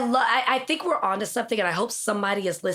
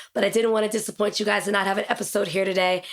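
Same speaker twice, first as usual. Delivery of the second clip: normal talking voice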